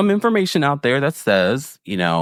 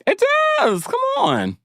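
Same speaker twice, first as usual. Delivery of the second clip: high-pitched insistence. Real, not acted. Host defending a jab to listeners, high-pitched insistence. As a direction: squeak it out, pushing the point.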